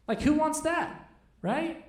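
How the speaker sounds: ordinary talk, nothing marked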